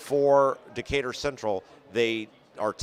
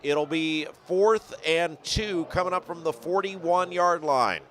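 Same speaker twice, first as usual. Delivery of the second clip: higher, lively live game call; play-by-play; 6 semitones higher than usual. Real, not acted.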